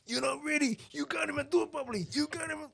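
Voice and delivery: high mocking bandmate voice; mimicking yelling bandmate. Reader mimicking the bandmate who yells, high mock whine. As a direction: squeak out the nagging like a whiny bandmate. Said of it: "always yell at everyone".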